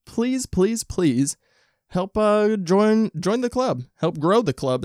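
high, drawn-out mock begging; hammed-up high-pitched plea; playful pleading. Begging listeners to join the club.

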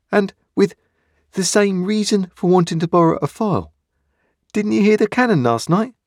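put-on a small boy speaking up to a convict; young boy Pip. A narrator's reading. Boy Pip's voice.

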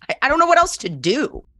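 flustered. Retold or imitated. Panicked about the first date.

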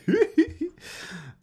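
high giggly nervous laugh. Real, not acted. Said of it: "the nervous laugh".

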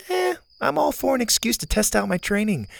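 a hint of a spunky girl; Zeta girl voice.

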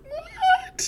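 squeaky high cracked what with a laugh; mock squeak of disbelief; voice breaking. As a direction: play the voice cracking on purpose. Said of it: "Your voice breaks".

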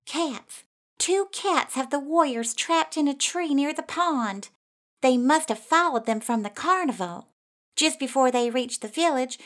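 high-pitched, urgent report; alarmed young mouse. A narrator's reading. Mouse reports the trapped warriors to the others, high alarmed voice. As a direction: start high and alarmed, then ease slightly.

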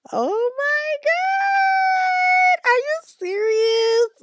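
high screaming mock amazement; shrieking surprise. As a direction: squeal in disbelief. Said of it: "And I'm like".

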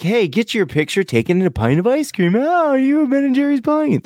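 high, bubbly tour guide voice; chirpy staff voice. Retold or imitated. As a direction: play the over-eager staff member. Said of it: "kinda like".

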